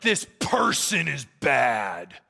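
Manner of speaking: slow, low mock denunciation; heavy, dragged-out verdict on an opponent; denouncing. Politician denouncing someone's character, mock-grave condemnation. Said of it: "denouncing someone's character".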